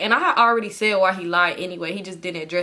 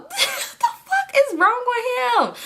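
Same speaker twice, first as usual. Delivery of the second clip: high, drawn-out exasperated cry; laughing disbelief; much slower than usual. Real, not acted. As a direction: squeal it out through a laugh. Exasperated laughing outburst at the viewers.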